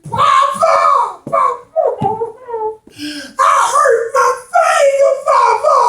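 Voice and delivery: put-on high, wailing little boy crying for papa; small child sobbing papa. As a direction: cry out like a hurt toddler. Child crying to his father.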